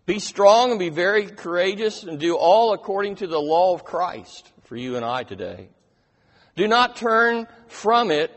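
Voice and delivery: loud, rising sermon reading of scripture; preaching. Acted. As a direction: proclaim the promise with full force. Preaching Joshua 1 at full force.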